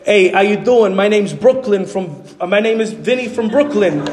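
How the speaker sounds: imitated Brooklyn accent; playful New York accent impression; Vinny Nizzo. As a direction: greet like a New Yorker from Brooklyn. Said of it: "the reason he talks like that".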